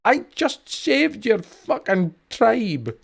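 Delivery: high, slow indignant yell; outraged protest at ingratitude; indignant outburst. Real, not acted. Indignant at ungrateful villagers.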